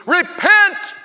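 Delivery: high, loud shout; loud preaching cry. Acted. John the Baptist preaching in the desert, loud cry. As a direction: cry it out with no microphone. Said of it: "That's the way he talked".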